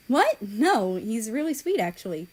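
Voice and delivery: startled exclaim. A narrator's reading. Japan answers South Korea, loudly surprised. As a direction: jump to defend him. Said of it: "she exclaimed".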